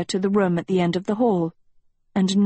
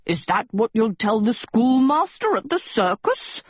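higher, thundering teacher's rebuke; 4 semitones higher than usual. A narrator's reading. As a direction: thunder it at the class. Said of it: "Professor Sheeks thundered".

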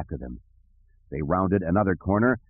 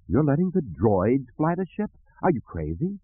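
higher, appalled disbelief; incredulous; 6 semitones higher than usual. Acted. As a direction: question their sanity in astonishment. Leia aghast, eyes went wide.

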